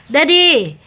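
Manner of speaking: high, loud, falling call. Real, not acted. Greeting Daddy on the vlog, loud high call. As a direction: call out loudly for attention.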